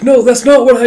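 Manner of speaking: high, loud protesting cry; flustered cry. Retold or imitated. Tama panicking to correct himself, a high loud cry. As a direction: blurt it out in panic.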